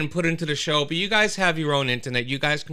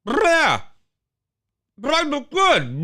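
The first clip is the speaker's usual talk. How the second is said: high, louder smug self-admiring mock voice; mock Donatella Versace; 11 semitones higher, 5 dB louder, slower than usual. Donatella admiring herself in the mirror, a high hammed-up imitation. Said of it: "looks at herself in the mirror and goes".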